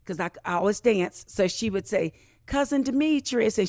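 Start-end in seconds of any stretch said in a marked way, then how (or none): 2.5-3.6 put-on child calling out high and bright; lightly voiced little cousin calling out; excited little girl.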